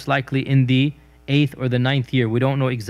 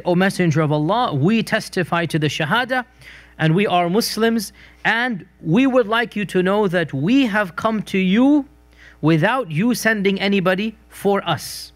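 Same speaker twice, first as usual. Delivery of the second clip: bragging retold; 6 semitones higher than usual. Tribesmen boasting to the Prophet of their conversion, mock bragging. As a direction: lay out their merits proudly, voice raised in pitch. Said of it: "they kept on bragging".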